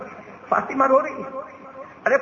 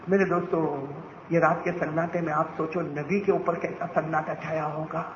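ordinary delivery

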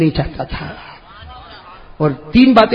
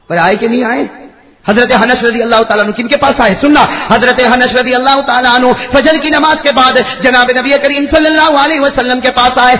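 ordinary talk, nothing marked

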